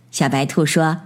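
usual speaking voice

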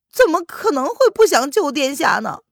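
high, loud pleading servant voice; an anxious servant begging to be heard. Acted. Servant pleads for his master.

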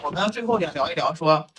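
ordinary delivery

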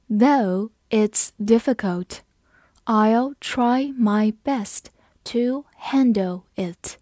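slow, phrase by phrase, words stretched; slow read. Shown as a demonstration. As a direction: read slowly in chunks for learners. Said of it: "我们慢慢来读". (分句慢速朗读)

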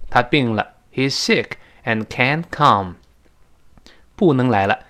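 ordinary talk, nothing marked

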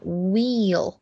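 "wheel" slow, long ee into a dark L; careful "whee-ul" for "wheel"; clear final L. Shown as a demonstration. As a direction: round "wheel" off with a full L. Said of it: "带有L那个音O".

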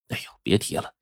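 hushed, sighing reluctance; dejected sigh. A narrator's reading. Nephew lowers head at question about sister, dejected. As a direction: sigh it out, barely voiced.

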